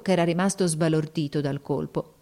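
ordinary delivery, nothing marked

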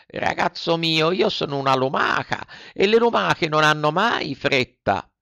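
the snail calmly refuses to hurry. A narrator's reading. (lumaca flemmatica)

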